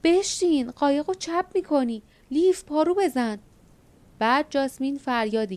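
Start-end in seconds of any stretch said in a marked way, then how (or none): 0.0-3.4 high, loud warning shouts; frantic orders shouted in a rocking boat; alarmed shout. A narrator's reading. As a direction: yell over the waves to stop her tipping the boat. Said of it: "باردا فریاد زد".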